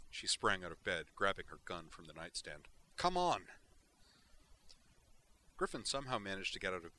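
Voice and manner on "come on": sharp, high urgent call to follow. Acted. Tally urging Griffin to hurry after her, urgent call. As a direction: hurry him out the door, loud.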